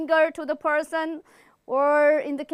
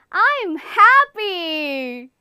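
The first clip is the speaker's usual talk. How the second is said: slow, beaming, higher and louder; happy; 5 semitones higher, 8 dB louder, much slower than usual. Shown as a demonstration. Teacher demonstrating a happy face to the class, beaming. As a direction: stretch out a big smiling I'm happy. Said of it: "smile and say".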